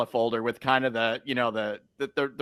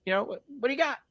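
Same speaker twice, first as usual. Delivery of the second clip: mimicking the homeowner up high; lightly voiced homeowner, much higher; high-pitched homeowner voice; 10 semitones higher than usual. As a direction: play the seller, pitched up and casual. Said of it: "the guy goes, he goes".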